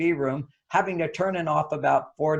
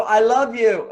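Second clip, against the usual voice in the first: laughing; 4 semitones higher, 5 dB louder, slower than usual. Retold or imitated. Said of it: "men go".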